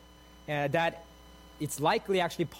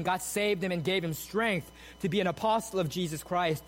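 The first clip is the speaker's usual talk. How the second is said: ordinary talk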